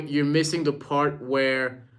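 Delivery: ordinary delivery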